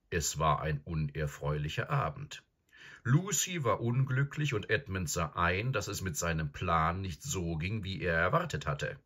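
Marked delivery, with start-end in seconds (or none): none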